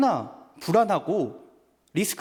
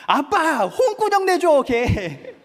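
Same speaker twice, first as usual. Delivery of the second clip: put-on much higher, louder giggling little girl; delighted child; 10 semitones higher, 6 dB louder, much slower than usual. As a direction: squeal it like a cheered-up kid. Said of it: "표정이 밝아지더니".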